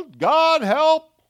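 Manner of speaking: loud, high cry for help; yelling for help. Retold or imitated. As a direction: shout it up in a panic. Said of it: "and we yell".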